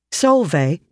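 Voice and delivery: usual speaking voice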